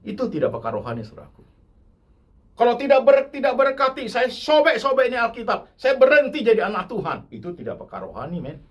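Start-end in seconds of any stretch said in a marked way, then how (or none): 2.5-7.3 loud, high ultimatum; threatening prayer. Retold or imitated. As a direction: threaten God like a thug. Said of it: "Jadi preman rohani itu". (ancaman keras dan tinggi seperti preman)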